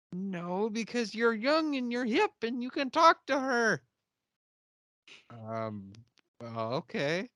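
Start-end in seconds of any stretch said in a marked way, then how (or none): 0.1-3.8 high, rambling, unprepared character voice; hammed-up helpless fretting; imitated flustered man. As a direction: dither in a high voice, out of your depth. Same flustered character pleading.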